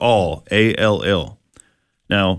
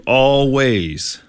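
"always" drawn out as ALL-ways; stress on ALL; 2 semitones higher than usual. Real, not acted. Preacher showing the "all" hidden in the verse, "always" drawn out. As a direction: stretch the first part of "always". Stretches word to highlight its "all.

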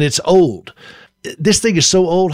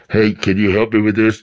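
disguised voice; 6 semitones lower than usual. Pretend. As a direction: lower put-on voice to fool a neighbor. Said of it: "sometimes I'll disguise my voice".

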